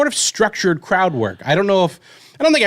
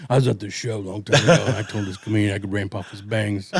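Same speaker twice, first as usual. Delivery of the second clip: a hint of a lower, softer grown-up telling his story; grown heckler voice; 9 semitones lower, 5 dB quieter than usual. Grown heckler to his friends, lightly voiced nostalgic retelling. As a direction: lightly voice the grown kid reminiscing. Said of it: "same fucking story, just in reverse".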